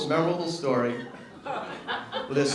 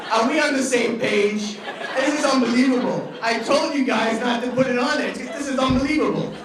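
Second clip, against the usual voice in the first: loud, higher exasperated rant; mock outrage; 6 semitones higher, 4 dB louder than usual. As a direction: imitate a boss blowing up at the crew. Bob voiced exploding at crew.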